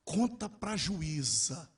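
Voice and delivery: very slow, stretched-out urging; a voice from nowhere, slowly prompting; inner prompting voice. Retold or imitated. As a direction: draw each word out like a mysterious prompting. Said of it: "uma voz veio e falou assim".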